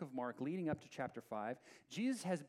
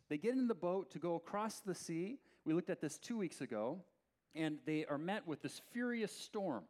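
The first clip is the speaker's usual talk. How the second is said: ordinary talk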